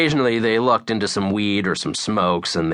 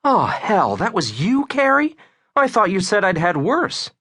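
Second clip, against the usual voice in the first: high-pitched, sassy woman's voice. A narrator's reading. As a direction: tease him back with tipsy sass. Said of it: "that sass in her voice".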